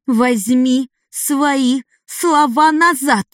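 put-on high, slow, furious girl's snap; angry barking girl. A narrator's reading. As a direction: bark it at him, stung. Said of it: "рявкнула Адайн".

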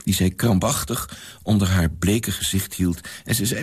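ordinary delivery

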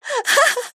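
put-on very high mad girlish laugh; high cackle. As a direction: burst into a crazed laugh. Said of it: "засмеялась Полина".

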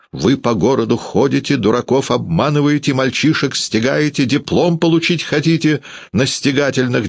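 high-pitched indignant tirade; worked-up father's outburst; angry cry. A narrator's reading. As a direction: berate the visitor, voice held high. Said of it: "закричал он".

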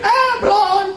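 high scoffing voice; high derisive scoff; mocking dismissal. Real, not acted. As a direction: scoff at such tears.

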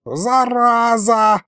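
high, long-drawn villain wail; theatrical high wail without full volume; wailed curse. A narrator's reading. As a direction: howl it, stretching every vowel. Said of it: "завопил колдун".

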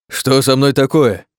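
loud, high, stretched cry of dismay; panicked outburst. Acted. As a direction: demand to know, horrified. Creature panics about its body.

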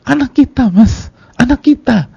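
hysterical mock wailing. Wife crying to her husband about their child, hammed-up wail. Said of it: "saya nangis depan dia".

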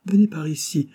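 crié, plus haut et plus fort; héler la fille à travers la salle; appel crié. A narrator's reading. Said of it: "lui cria le sculpteur". (le sculpteur appelle la bouquetière, crié)

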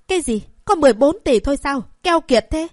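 outraged disbelief. Acted. Mother outraged at the bank card, shrill disbelief. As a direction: scoff at the stingy amount.

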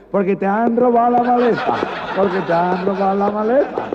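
imita la cantinela aguda y fuerte; burla cantarina. Acted. Comisario imita el canturreo burlón. (comisario burlándose cantando)

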